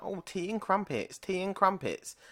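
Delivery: mock American. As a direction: tease like an American gushing over tea and crumpets. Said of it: "As soon as they heard my accent".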